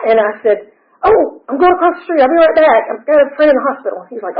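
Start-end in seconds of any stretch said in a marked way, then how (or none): 1.0-4.1 breezy cover story. Retold or imitated. Covering for AA meeting trip.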